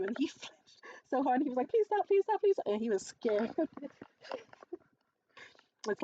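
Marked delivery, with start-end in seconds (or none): 1.7-2.7 high, loud imitation of panicked pleading; hammed-up terrified plea; mock frantic begging. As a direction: beg in bursts, mimicking someone scared. Mimics scared man begging.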